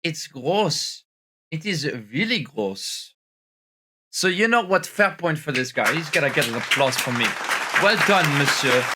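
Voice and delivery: hammed-up French accent on English; put-on French accent. French persona greeting his viewers, in a put-on French accent. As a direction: play a tired Frenchman mangling English. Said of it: "this French accent".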